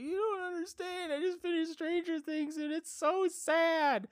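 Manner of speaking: high, wailing imitation of weepy self; mock crying. Husband sobbing to his wife about Stranger Things, mock crying. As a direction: blubber it in a squeaky, hammed-up wail. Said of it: "you're crying about a damn show".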